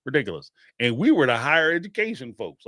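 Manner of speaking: usual speaking voice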